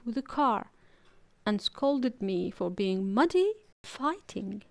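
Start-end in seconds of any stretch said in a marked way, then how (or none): none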